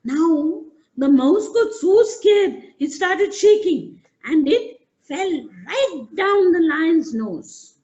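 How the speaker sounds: storyteller thrilled by the mouse's fall; excited telling. A narrator's reading. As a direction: rush it with giggly excitement.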